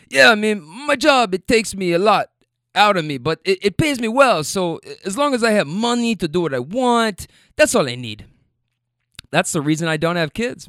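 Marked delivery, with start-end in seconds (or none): 0.1-8.2 put-on South American accent. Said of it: "so I'm trying to do the accent".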